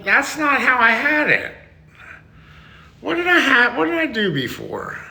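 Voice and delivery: high-pitched exasperated complaint. Pretend. Streamer failing to recreate a funny look, exasperated. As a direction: pitch it way up, baffled by the failed attempts.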